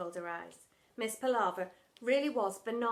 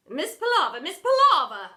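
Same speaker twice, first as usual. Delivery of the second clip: loud calling, louder on second; 6 semitones higher, 15 dB louder than usual. Acted. Headmistress calling to the frozen teacher, loud. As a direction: call to snap her out of it.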